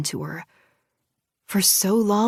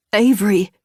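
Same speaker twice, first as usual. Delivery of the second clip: alarmed shout; 2 semitones higher, 9 dB louder than usual. Acted. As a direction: shout her name, alarmed and loud. Jordan finds her choking.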